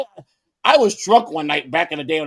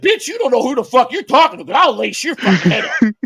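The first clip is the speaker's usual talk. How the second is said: loud, higher, furious retold warning; angry threat; 8 semitones higher, 6 dB louder than usual. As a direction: bark it like a street tough.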